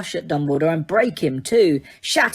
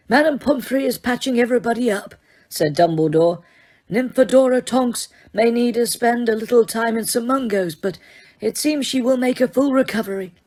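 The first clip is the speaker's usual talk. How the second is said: put-on higher, gentle old headmaster voice; a calm, lightly voiced old wizard; Dumbledore, calm; 6 semitones higher than usual. A narrator's reading. Headmaster reassuring Harry, calm old wizard voice.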